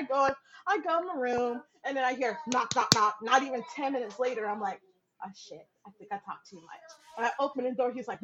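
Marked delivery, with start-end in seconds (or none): 5.2-6.9 much softer nervous aside; softer worried inner voice; muttered thought. Retold or imitated. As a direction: say it under your breath.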